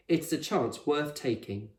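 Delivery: ordinary delivery, nothing marked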